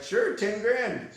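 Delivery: high and slow, laughing through the price; laughing doctor. Retold or imitated. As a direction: let the laugh break into the quote. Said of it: "Doctor's like".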